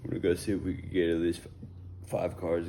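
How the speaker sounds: hiccuping mid-sentence; words broken by hiccups. Real, not acted. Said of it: "Excuse the hiccups".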